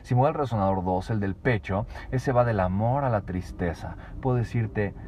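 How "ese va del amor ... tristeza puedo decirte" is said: normal talking voice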